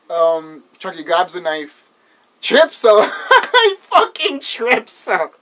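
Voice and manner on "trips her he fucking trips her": high, loud laughing retelling; cracking up. Real, not acted. Recapping a favorite horror scene, cracking up. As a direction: tell it through helpless laughter.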